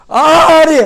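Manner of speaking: loud, high, fired-up preaching; forceful preaching. Acted. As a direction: preach it out at the top of your voice.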